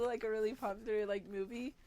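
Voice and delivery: normal talking voice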